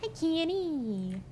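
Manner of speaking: high, drawn-out southern Kenny; slow, high southern greeting; Cassidy's southern accent. Shown as a demonstration. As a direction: say it the way Cassidy does, with an I. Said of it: "how she says Kenny".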